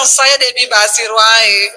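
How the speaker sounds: low, slow giving-in; imitated husband. Husband picks the real wife, low slow resigned imitation. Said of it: "Dia bilang".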